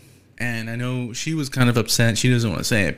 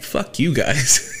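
mock grudge; 2 semitones higher, much slower than usual. Retold grudge at no-shows.

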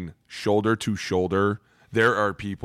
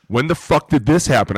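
raised, louder demanding question; retold outrage; 5 semitones higher, 5 dB louder than usual. Staff learning a player left combine, retold outrage. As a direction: act out irate disbelief. Teammates pissed at roommate leaving.

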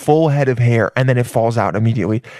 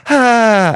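high, loud mock-ecstatic ah; reporter's gasp; 8 semitones higher, 5 dB louder than usual. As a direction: cry out like an overwhelmed reporter. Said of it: "whatever, is like".

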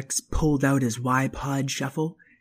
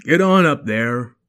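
dad voice; 6 dB louder than usual. Acted. Father voice.

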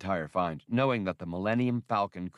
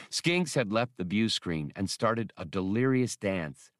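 ordinary delivery, nothing marked

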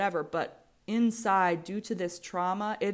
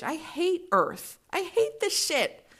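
much higher, slow, fed-up groan of disgust; exasperated rant; 9 semitones higher, 3 dB louder, slower than usual. Real, not acted. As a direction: spit it out like you're done with the world. Venting about being human.

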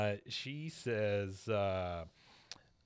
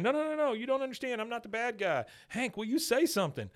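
much higher frantic protest; pleading Peggy; 11 semitones higher than usual. Retold or imitated. Peggy pleading as security drags her out, high frantic protest. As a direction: beg desperately while being dragged.

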